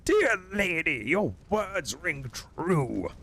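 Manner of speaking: slow, very high courtly voice; bow and offer your post; high-pitched mock-chivalrous knight; gallant knight.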